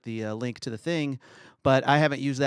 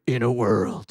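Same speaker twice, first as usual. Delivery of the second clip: trailer voiceover; 7 dB louder, slower than usual. Retold or imitated. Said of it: "he was always the guy who did".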